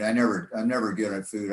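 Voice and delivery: ordinary talk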